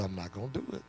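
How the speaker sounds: low, quiet voice of God; quiet, unbothered refusal from God; God's reply. Acted. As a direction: answer him softly and firmly as God. God answers Mister Vincent.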